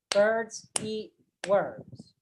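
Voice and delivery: BIRDS, EAT, WORMS slow, a beat apiece; separate, measured beats on birds, eat, worms; stressed words only. Shown as a demonstration. Teacher isolating the clapped words, slow even stress. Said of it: "So we do not clap them".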